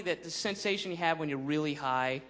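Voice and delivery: ordinary talk, nothing marked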